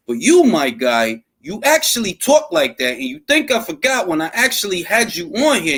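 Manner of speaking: ordinary talk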